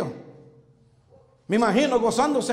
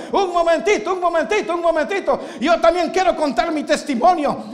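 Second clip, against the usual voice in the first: higher, excited, laughing Lazarus interrupting; eager Lazarus; 4 semitones higher than usual. Acted. Lazarus cuts into the saints' testimonies, eager. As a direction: burst in, bubbling with joy. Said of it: "cuando escuchó su testimonio dijo".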